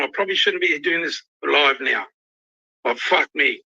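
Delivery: ordinary delivery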